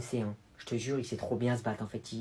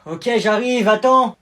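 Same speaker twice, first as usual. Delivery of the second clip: loud, high shout from a distance; calling back; 9 semitones higher, 16 dB louder, much slower than usual. Acted. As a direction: shout an answer across the house. Answering the call.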